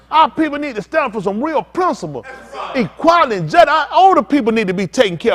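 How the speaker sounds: high, loud preaching at full force, building to a shout; fiery preaching. Acted. Preacher rousing the congregation, loud and high.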